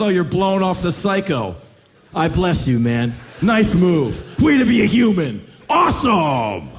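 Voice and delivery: loud, mocking mock-praise; sarcastic scolding. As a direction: belt out the sarcasm in indignation. Indignant God scolding the one refusing.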